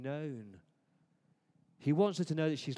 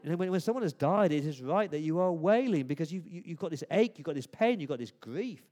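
louder, higher, pressing each grief word; impassioned preaching; 4 semitones higher, 7 dB louder than usual. Acted. Preacher urges the congregation not to sanitize grief, impassioned. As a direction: preach it with full force.

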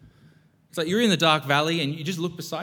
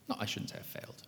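whispered aside; 4 semitones lower, 13 dB quieter, faster than usual. Real, not acted. Correcting himself, aside.

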